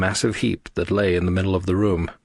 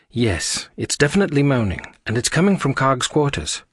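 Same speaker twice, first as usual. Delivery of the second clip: brisk higher voice, a touch urgent; higher character voice; 7 semitones higher than usual. Acted. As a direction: voice her quick and certain. Aria confirms the source.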